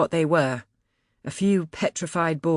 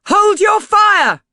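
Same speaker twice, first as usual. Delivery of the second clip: loud, high, slow bellow; 11 dB louder, slower than usual. A narrator's reading. Narrator ordering the crew to stop shooting, bellowing. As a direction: stretch each word across the deck.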